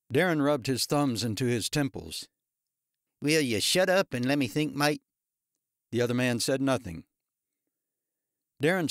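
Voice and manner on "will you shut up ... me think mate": put-on Australian accent. A narrator's reading. Darren snaps at tattooed man.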